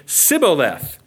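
deliberate "Sibboleth", opening on a hissed S. Shown as a demonstration. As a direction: say "Sibboleth" slowly, with a plain S for the SH. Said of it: "you couldn't pronounce Shibboleth".

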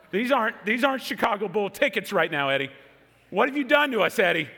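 mildly panicked, high-pitched plea; retold panic. Stepson to stepfather over wrong tickets, mildly panicked. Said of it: "fear just came over me".

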